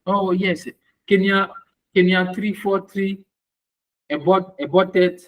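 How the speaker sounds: normal talking voice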